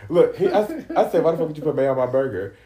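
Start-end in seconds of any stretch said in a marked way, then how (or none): 1.2-2.5 fast, louder, higher indignant demand; worked-up, rapid-fire mock outrage; retold outrage. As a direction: fire off the question in disbelief. Repeats outraged question after interruption.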